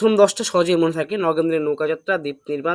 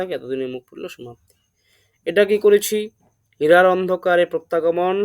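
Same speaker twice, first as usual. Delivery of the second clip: ordinary talk, nothing marked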